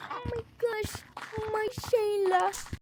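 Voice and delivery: high, drawn-out theatrical wail; mock lament. Gamer bemoaning "my Shayla" to viewers, hammed-up lament. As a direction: wail it slowly, hammed-up grief.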